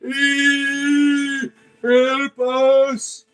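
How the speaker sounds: high, loud, wailing mock demon cry. Streamer mocking exorcists as a whimpering demon, high and hammed-up. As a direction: ham up a fleeing demon, shrieking in panic.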